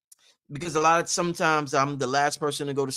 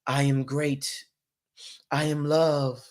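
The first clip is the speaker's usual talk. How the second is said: mock affirmation; 3 semitones lower, 3 dB quieter, slower than usual. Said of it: "you be into your corner".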